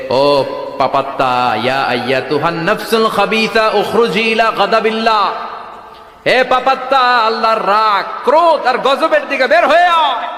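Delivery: scolding threat. Acted. Angel of death to a sinful soul, threatening rebuke rising to a shout. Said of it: "ধমক দিয়ে বলে".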